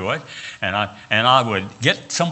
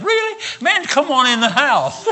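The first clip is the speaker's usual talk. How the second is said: high-pitched, exuberant imitation of a thrilled host; mock delighted welcome; 13 semitones higher, 3 dB louder than usual. West Virginian welcoming a fellow southerner at his door, acted delight. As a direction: burst into an eager, acted invitation.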